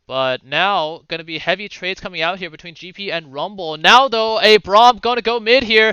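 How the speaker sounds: esports caster building in energy; live play-by-play. Acted. Caster to viewers as Braum heads mid, building play-by-play. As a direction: lift the call as action resumes.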